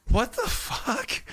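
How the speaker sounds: slow, high-pitched incredulity; retold disbelief. Host hearing house is at seventy-five, drawn-out disbelief. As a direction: stretch it out, baffled.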